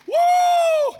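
high loud whoop of praise; joyful woo. Real, not acted. Preacher's cry.

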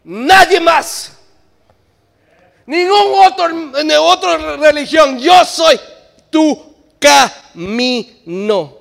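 loud, slow divine proclamation; Jesus declaring he is the way; Jesus proclaiming. Acted. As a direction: thunder it as Jesus. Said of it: "Y Jesús dice".

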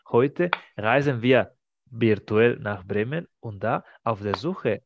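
ordinary talk, nothing marked

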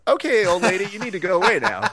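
comic exasperated send-away; mock dismissal. Fight fan shooing away old lady, comically dismissive.

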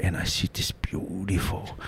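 put-on hushed, slow Islander-accented admiration; fob accent. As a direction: whisper it in a Tongan accent. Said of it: "the fob accent".

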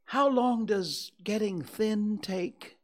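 high, slow, worried question; drawn-out high-pitched worry; anxious bear. A narrator's reading. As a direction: ask anxiously as a little bear. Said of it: "asked Pooh anxiously".

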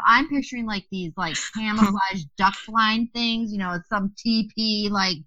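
usual speaking voice

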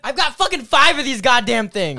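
loud, slow exasperated rant; loud annoyed. Real, not acted. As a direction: blurt it out in frustration. Annoyed about bottles.

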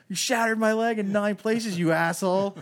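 high, loud furious accusation; imitating a furious soldier; angry shout. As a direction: yell it at the man who shot you. Angry wounded soldier quote.

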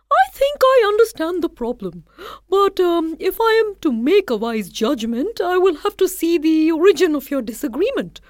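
high, bright-eyed rabbit voice; clever rabbit. Acted. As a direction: play the wise little rabbit.

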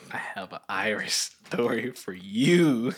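slow, stretched-out repeat of the line; drawn-out announcement. Pretend. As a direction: draw out every word, especially YOU. Same line repeated as story announcement.

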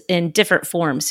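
ordinary talk, nothing marked

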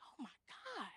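near-whispered retold shock, very soft; acted hushed disbelief; hushed dismay. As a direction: breathe it out under your breath. Retelling her shocked, pained reaction.

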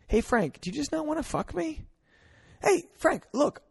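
put-on high-pitched girlfriend voice; man imitating a girl, high voice; playing the girlfriend. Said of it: "I'm being the girl right now".

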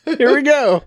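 high mock eagerness; mock excitement. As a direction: ham up the kickoff. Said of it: "It's just like".

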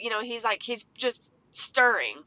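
ordinary talk, nothing marked

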